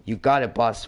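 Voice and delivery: ordinary talk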